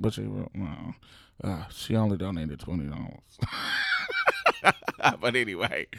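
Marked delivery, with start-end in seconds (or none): 3.4-5.1 very high howling laugh; shrieking laugh. Real, not acted. Laughing at the landlord's tiny donation in a high shriek. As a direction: burst out laughing.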